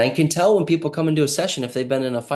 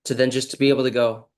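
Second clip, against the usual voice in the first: ordinary talk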